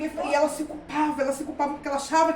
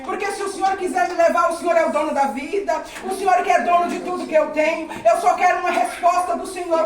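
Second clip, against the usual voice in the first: louder, impassioned testimony preaching; fervent preaching; 4 semitones higher, 4 dB louder than usual. Retold or imitated. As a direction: retell a pleading prayer at full voice. Preaches her remembered prayer. (pregação fervorosa, em voz alta)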